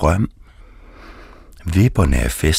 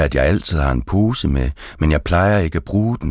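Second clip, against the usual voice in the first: plain reading voice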